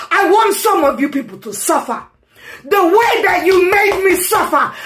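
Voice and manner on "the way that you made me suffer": high, loud outburst; angry shout. Real, not acted. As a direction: cry out the grievance. Rant about suffering.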